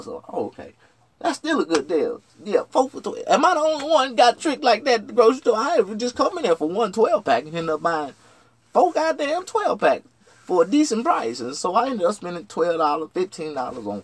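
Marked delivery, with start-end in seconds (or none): none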